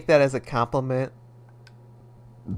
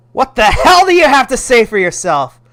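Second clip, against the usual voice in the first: loud, higher furious scolding; angry mom; 9 semitones higher, 12 dB louder than usual. Acted. Mother scolding her son for his fantasies, loud and furious. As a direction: yell at him like a furious mother.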